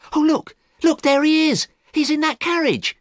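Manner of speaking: very high, loud squeal of excitement; excited shout. A narrator's reading. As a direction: call out urgently at the top of the pitch. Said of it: "Sybil shouted".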